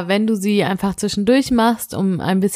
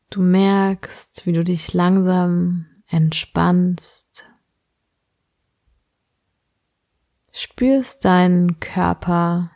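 sleep meditation; slower than usual. Acted. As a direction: guide the listener gently into sleep.

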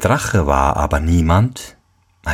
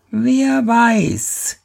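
put-on old woman voice.

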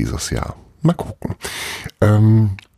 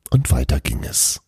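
ordinary delivery, nothing marked

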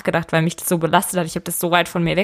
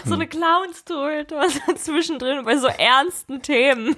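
amused; 6 semitones higher than usual. Real, not acted. Still amused, teasing co-host.

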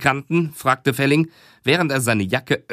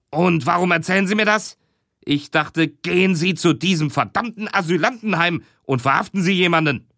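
higher, annoyed interruption; irritated boss; 6 semitones higher than usual. Acted. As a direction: cut in high and irritated.

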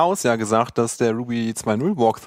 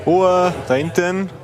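slow, raised call for order; 8 semitones higher, 4 dB louder, slower than usual. Acted. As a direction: hush the back row like a teacher. Host calls listeners to order.

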